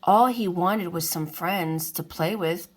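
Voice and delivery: plain reading voice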